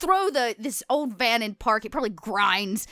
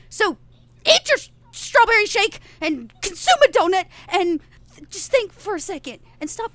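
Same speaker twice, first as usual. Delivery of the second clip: teary plea; 7 semitones higher, 5 dB louder than usual. Acted. Leader pleading with the group.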